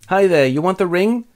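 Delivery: high, put-on greeting voice; Galadriel. Elf lady receives Frodo, high put-on greeting. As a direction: greet them in a high acted voice.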